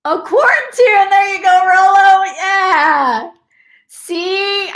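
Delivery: high, loud, thrilled reaction; delighted cheering. Real, not acted. Host reacting to Rolo's comment, delighted cheering. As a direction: cheer them on at full lift.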